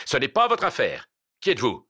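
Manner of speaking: angry retort ending in a shout; furious dismissal. Acted. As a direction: cut him off and order him away. Furious judge dismisses him.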